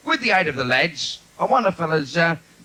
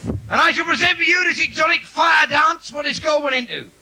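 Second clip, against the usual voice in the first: showman announcement; 12 semitones higher than usual. Acted.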